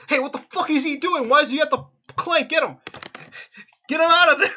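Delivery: high, loud panicked yelling; panicked screaming. Acted. Guard inside yelling to get him away from the crank, panicked screaming. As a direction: yell in alarm from behind the drawbridge. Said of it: "You hear screaming coming from within".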